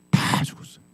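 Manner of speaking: hushed whispered ending. Real, not acted. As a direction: drop to a whisper. Solemn whispered conclusion. (속삭이듯 낮게 말함)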